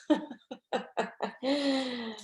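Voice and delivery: amused laugh trailing off. Real, not acted. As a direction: laugh at your own funny reading. Laughs at cartoon she read.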